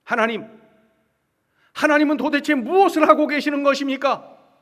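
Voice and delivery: loud, high, impassioned plea; acted outcry of a prophet demanding answers; protesting prayer. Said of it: "하나님께 항변하면서".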